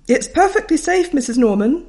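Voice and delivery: teacher reassuring a worried parent loudly; announcing teacher. Retold or imitated. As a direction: declare it heartily. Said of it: "Missus Kelvin announced".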